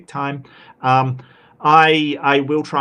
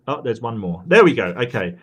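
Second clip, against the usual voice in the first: ordinary talk, nothing marked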